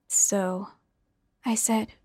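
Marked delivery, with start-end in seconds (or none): 0.1-0.7 emotionless. A narrator's reading. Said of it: "deliberately killing all the emotion in my voice".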